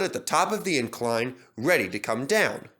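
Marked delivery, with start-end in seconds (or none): none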